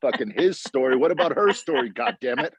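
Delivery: high-pitched joking rant; mock outrage. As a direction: rant in playful indignation. Joke rant amid laughter.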